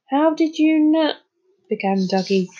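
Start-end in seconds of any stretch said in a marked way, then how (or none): 0.1-1.2 high, slow question cut short; breaking off. A narrator's reading. Little boy caught out over the invitation, breaking off. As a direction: start asking, then stop dead. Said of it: "then stopped as a blush spread".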